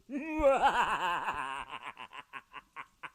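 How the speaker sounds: long gloating cackle; a hammed-up villain laugh; evil mad scientist cackle. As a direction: cackle over your creation.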